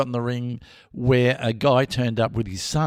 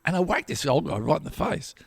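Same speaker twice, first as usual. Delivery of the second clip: ordinary delivery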